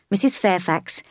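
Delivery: usual speaking voice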